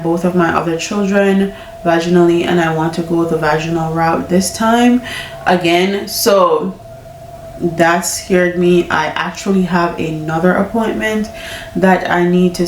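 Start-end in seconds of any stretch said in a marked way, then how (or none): none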